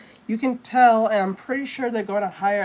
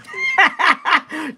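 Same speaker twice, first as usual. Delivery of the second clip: a sudden high laugh at his own joke; amused high laugh; high burst of laughter; 10 semitones higher, 5 dB louder than usual. Real, not acted. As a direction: break into a high-pitched laugh.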